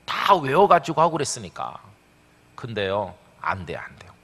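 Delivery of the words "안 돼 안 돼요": whisper. Real, not acted. Admits memorizing failed.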